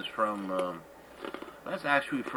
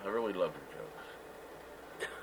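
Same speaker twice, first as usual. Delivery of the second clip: whispering; 6 semitones lower, 11 dB quieter than usual. Real, not acted. Teasing aside after the jokes.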